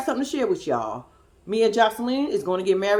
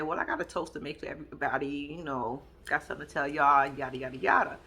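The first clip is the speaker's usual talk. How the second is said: low, soft quick run-through of a toast; imitating a speaker, low and understated; retold toast announcement; 8 semitones lower, 8 dB quieter, faster than usual. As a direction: toss it off low and casual. Quoting a toast being announced.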